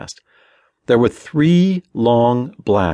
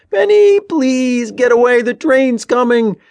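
high, loud, desperate plea; panicky pleading yell; 6 dB louder than usual. A narrator's reading. Tommy begging Ben off the tracks, panicky yelling. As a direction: beg your brother at full voice. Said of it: "his voice even higher and panicky".

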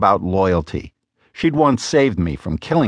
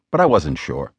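plain reading voice

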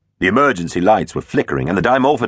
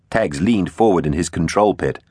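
ordinary delivery, nothing marked

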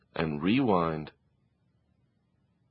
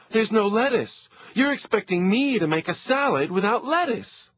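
put-on whiny teenager; 11 semitones higher than usual. Teen complains.

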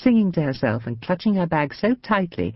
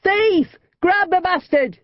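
high, loud cry of thief; raised alarm shout; 4 dB louder, slower than usual. A narrator's reading. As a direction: yell it after the running thief. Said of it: "the cry went up".

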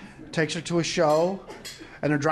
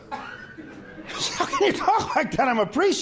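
higher-pitched mock reproach from a priest; lightly acted shocked priest; 8 semitones higher, much slower than usual. Priest rebukes a young woman for swearing, mock shocked. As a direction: scold as a scandalized priest, in jest.

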